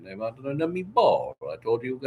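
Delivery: ordinary delivery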